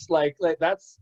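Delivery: ordinary delivery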